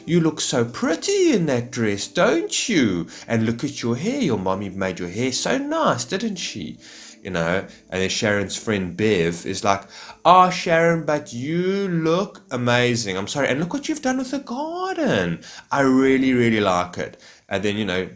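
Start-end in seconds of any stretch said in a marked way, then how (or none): 0.1-6.8 high, swooping put-on sweetness; mock cooing. Neighbour admiring her friend's child, mock high-pitched cooing. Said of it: "when you hear the high-pitched voices". 10.2-17.2 loud, high start with swooping, stretched praise; mock gushing friend. Visiting friend praising the neighbour's garden, high mock gushing. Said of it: "when you hear the high-pitched voices".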